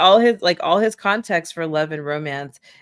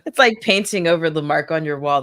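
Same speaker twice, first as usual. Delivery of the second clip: ordinary talk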